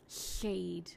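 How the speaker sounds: "seed" whispered with a slushy side-flowing s; whispered lateral lisp. Shown as a demonstration. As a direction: breathe "seed" with air escaping sideways. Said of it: "when I have a lateral posture ... this is what it sounds like".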